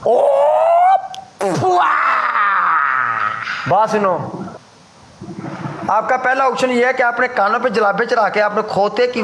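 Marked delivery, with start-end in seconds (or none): 0.1-3.7 drawn-out wow. Real, not acted. Drawn-out exclamation.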